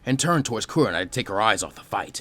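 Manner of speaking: ordinary delivery, nothing marked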